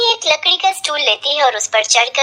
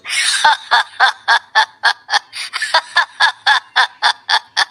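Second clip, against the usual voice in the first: long booming belly laugh; big laugh. Acted. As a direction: laugh hard like an ox. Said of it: "बैल की तरह क्यों हंसे".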